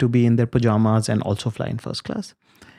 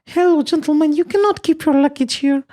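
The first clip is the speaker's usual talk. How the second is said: put-on much higher, louder scolding old lady voice; mocking old lady; 3 dB louder than usual. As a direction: ham up a bossy old lady shooing him off.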